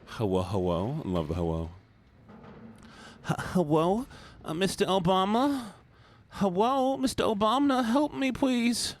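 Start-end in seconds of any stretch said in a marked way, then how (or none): 3.3-8.9 high, pleading cry for help; desperate drowning victim. Acted. Streamer voicing a drowning victim, high and pleading. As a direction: beg Mister Obama to save you. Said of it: "Please, Mister Obama, I'm drowning".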